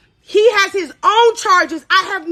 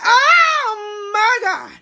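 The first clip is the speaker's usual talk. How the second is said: drawn-out shock; 5 semitones higher, much slower than usual. Real, not acted. Learning of his charges, crying out slowly in shock. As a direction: stretch it out in horror. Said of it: "So I'm like".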